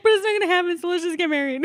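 high, loud whine breaking into laughter; mock tearful. Bride arguing to elope anyway, high mock-tearful whine. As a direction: play her hammed-up despair, laughing through it. Retold bride despairs, then insists.

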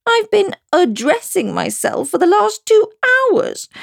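lightly voiced, high fretful queen; a touch of weary whining. Poor Queen complaining to Alice. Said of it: "groaned the poor Queen".

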